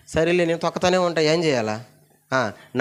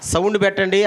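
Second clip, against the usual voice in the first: ordinary delivery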